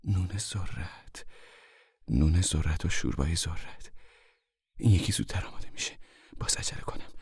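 soft, private musing; talking to herself. A narrator's reading. Mother plans breakfast over the fire, talking to herself. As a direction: think aloud under your voice. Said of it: "بعد به خودش گفت".

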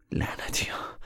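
whispered, anguished swearing; hushed curse. Acted. Son remembers his friend's killing, cursing in a whisper. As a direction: curse under your breath. Bitter memory of killed friend.

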